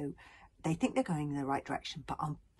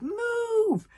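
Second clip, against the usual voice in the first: very high, loud drawn-out scream; frantic shove of a shout; 7 dB louder than usual. A narrator's reading. Tsar tries to push Bodkin onward, screaming. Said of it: "screamed Tsar".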